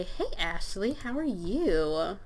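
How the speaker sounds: slow, drawn-out hello to a crush; lingering, flirty pretend greeting; sweet greeting.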